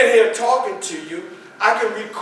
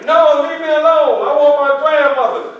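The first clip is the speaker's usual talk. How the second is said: put-on higher, louder childish protest; boy wanting his grandmother, higher and louder; upset child; 7 semitones higher, 7 dB louder than usual. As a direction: shout him off, sulking. Child voiced refusing.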